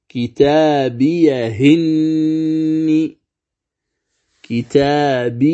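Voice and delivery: كتابيهن said slowly with the hamza's kasra moved onto the ha, no stop; moving the hamza vowel. Shown as a demonstration. Teacher slowly demonstrating كتابيهن to students. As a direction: recite كتابيهن slowly as one joined word, the ha carrying kasra. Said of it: "نقل حركة الهمز إلى هاء السكت".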